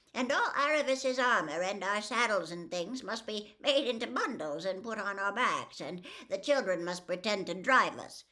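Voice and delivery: a humble horse eagerly sharing her plan; gentle mare. Acted. Hwin the mare proposes disguise.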